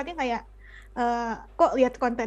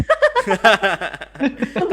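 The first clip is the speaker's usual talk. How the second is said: sneering villain cackle; evil laugh; 3 semitones lower, 4 dB louder than usual. Acted. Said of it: "Tawa jahat dulu ya".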